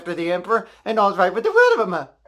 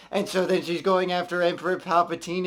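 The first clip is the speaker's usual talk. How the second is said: ordinary talk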